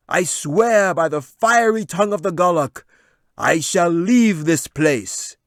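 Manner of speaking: high, loud, slow proclamation; loud wizard. Acted. Wizard swearing to the crowd he will leave, loud. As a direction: declaim like a scheming wizard.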